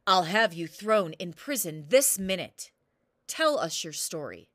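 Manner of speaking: loud, booming royal command; roaring king. Acted. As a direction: bellow the order down from the throne. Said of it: "roared the king".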